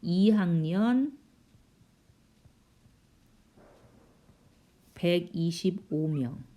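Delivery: slow dictation with long pauses; drawn-out words, long gaps between phrases; slow writing-along pace. Real, not acted. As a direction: say each phrase slowly as you write it out. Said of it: "쓰려니까 시간이 많이 걸리네요".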